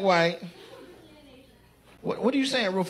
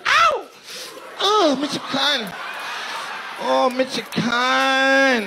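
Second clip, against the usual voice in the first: high, slow drawn-out yell of Michoacan; mock scream; 6 semitones higher, 3 dB louder, much slower than usual. Comedian imitates audience member screaming her hometown. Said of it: "She screamed".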